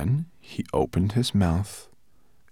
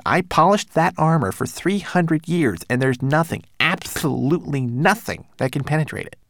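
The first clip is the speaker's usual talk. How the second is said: high little manservant voice; fussy tiny homunculus; 8 semitones higher, 5 dB louder than usual. Acted. Twigleg insists.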